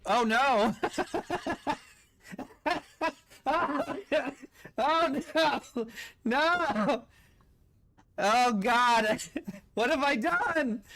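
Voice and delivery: high, loud cries breaking into laughter; laughing dismay. Real, not acted. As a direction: cry out helplessly through real laughter. Gamer cracks up at own blunder.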